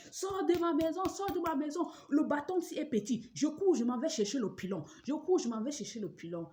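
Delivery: high, loud frantic yelling; imitated screaming. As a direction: shriek out the mother-in-law's rant. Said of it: "Elle a commencé à crier". (cris imités de la belle-mère)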